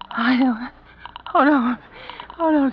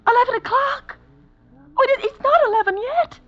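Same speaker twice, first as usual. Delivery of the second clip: much higher, startled disbelief; alarmed surprise; 10 semitones higher than usual. Acted. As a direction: jolt of alarm. Shocked by the time.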